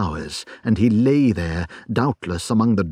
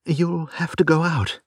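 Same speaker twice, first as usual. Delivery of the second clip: wife's voice; 7 semitones higher than usual. Acted. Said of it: "said she".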